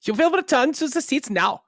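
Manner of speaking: high, loud commanding order; mock angry German. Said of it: "You just have to be angry".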